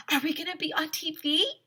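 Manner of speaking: high eager question; little girl voice; excited girl. Acted. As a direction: squeal with hope.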